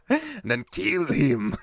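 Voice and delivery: slow, sinister villain command; booming echoing villain monologue. Retold or imitated. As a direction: drawl it like a cartoon villain. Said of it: "supervillain voice".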